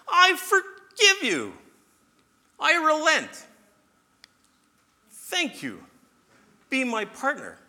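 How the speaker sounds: very high, loud jubilant cry; exultant God, loud and high; excited. Acted. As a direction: shout with joy, stretching out the words. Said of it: "He almost sounds excited".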